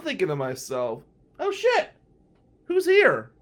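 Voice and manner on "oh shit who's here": high, loud startled yelp; shocked panic. Retold or imitated. Comic thinking at funeral, hit with panic, high and loud. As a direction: blurt out in sudden alarm. Inner panic at surprise eulogy.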